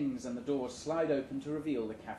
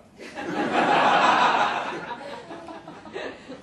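high, loud breathy laugh; shrill cackle at the carnage; wild laugh; 14 semitones higher, 11 dB louder than usual. A narrator's reading. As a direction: burst into a manic laugh. After the explosion.

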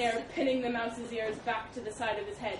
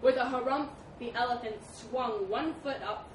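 plain reading voice

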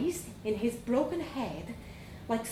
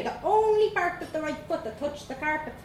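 ordinary delivery, nothing marked

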